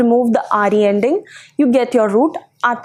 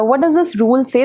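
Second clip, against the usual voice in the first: usual speaking voice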